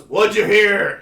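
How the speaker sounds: loud, high mock retort; cranky old man. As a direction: throw it back like a crabby old guy. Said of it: "then he goes".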